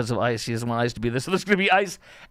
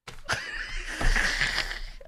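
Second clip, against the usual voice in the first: high, wheezing laugh; helpless laughter; 5 dB quieter than usual. Real, not acted. Host cracking up at the clip, wheezing laughter. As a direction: laugh until only air comes out.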